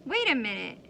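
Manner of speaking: ordinary delivery, nothing marked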